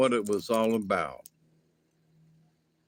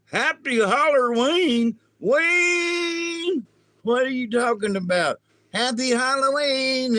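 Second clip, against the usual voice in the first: baffled high repeat; 10 semitones higher, 3 dB louder than usual. Acted. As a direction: echo the child's greeting in total confusion. Alien confused mimicking kid.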